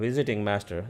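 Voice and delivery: normal talking voice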